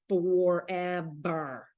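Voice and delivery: "Forever" stretched very slowly and low; slow weary groaning "Forever"; drawn-out forever. Retold or imitated. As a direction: drag "Forever" out long like it never ends. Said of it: "Danielle's like".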